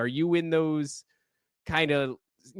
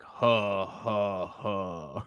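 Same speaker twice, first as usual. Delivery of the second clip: slow, deep "huh, huh, huh" chuckle; super low laugh; 4 semitones lower, much slower than usual. A narrator's reading. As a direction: imitate a low, ominous laugh in separate beats. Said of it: "super low, low laugh".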